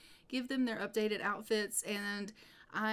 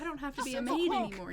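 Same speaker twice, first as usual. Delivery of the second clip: quick, higher, gushing relief; excited wife; 4 semitones higher, 3 dB louder, much faster than usual. Retold or imitated. Wife tells husband she's freed from maid work, excited and quick. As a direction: rattle it off like a thrilled wife. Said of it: "like, so excited".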